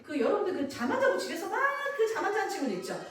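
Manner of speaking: ordinary talk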